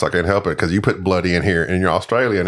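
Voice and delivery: normal talking voice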